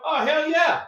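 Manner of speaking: high, cocky retold reply; admitting it. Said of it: "I go".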